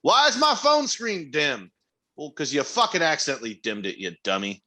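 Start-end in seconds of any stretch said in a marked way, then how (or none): 0.0-1.7 high, slow mock complaint; helpless relative. Family calling with a basic phone question, mock helplessness. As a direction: imitate a baffled relative, pitched up and drawn out. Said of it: "I'll get a call of".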